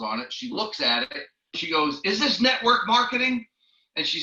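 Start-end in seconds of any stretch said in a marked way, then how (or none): 2.0-3.5 indignant shock. Retold or imitated. Angry lady in hotel lobby.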